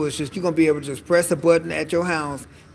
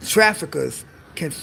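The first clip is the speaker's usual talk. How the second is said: ordinary talk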